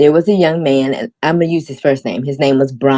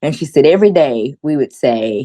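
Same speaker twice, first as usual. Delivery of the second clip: normal talking voice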